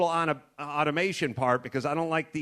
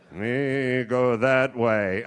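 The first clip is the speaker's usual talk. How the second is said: very slow, low and terse; stoic tracker; 3 semitones lower, 4 dB louder, much slower than usual. Pretend. Indian tracker slowly repeating his direction to the cowboy. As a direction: lay down each word with calm certainty.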